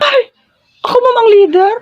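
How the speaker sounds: high, loud thrilled squeal; named group leader, squealing excitedly; squealing excitement. Acted. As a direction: shriek with delight.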